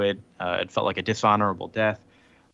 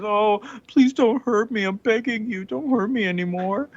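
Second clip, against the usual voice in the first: mock groveling; 11 semitones higher than usual. Hulk groveling to Talos, hammed-up pleading. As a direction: beg pathetically for mercy in a put-on whine. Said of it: "starts groveling to Talos and says".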